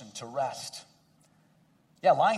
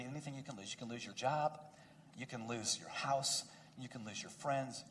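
hushed, intimate pastoral plea; soft, earnest pastoral appeal; 6 semitones lower, 12 dB quieter than usual. Acted. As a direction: lean in close and soften the voice.

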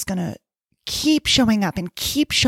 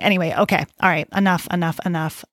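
ordinary talk

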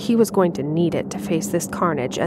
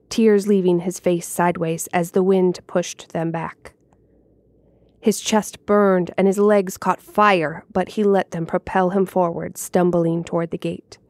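usual speaking voice

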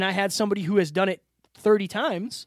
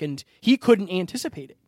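ordinary delivery

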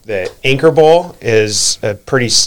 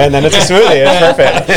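laughing; 8 semitones higher, 7 dB louder than usual. Real, not acted. Joke about the thawed bowl becoming a smoothie.

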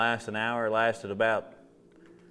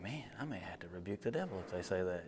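hushed whispered aside; 3 semitones lower, 14 dB quieter than usual. Retold or imitated. Preacher whispering a joke to the congregation.

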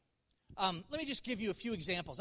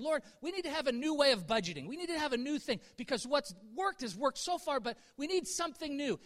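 higher, earnest pleading, re-enacted; retold urgent prayer; 6 semitones higher, 4 dB louder than usual. Preacher re-enacts praying about household budget, urgent pleading. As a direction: plead upward, rising to a louder cry. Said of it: "I'm praying as my wife and I".

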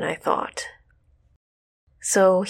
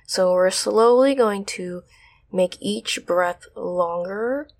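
ordinary talk, nothing marked

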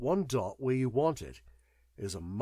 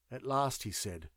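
ordinary delivery, nothing marked

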